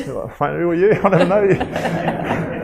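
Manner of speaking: bewildered, half laughing; laughing disbelief. Retold or imitated. Soldier laughing at the stranger who knows his kids. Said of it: "taxi driver scene".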